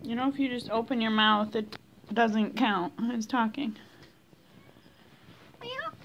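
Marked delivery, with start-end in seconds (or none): 5.6-5.9 very high, soft mock meow; tiny high meow. Real, not acted. Meowing back at the cat in a tiny high voice. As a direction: squeak a little meow.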